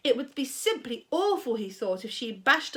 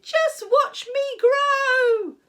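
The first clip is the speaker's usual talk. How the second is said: much higher, louder, slow crowing voice; crazed old grandma; 9 dB louder, much slower than usual. A narrator's reading. Grandma boasting to George. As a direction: crow it like a mad old witch. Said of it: "she shouted".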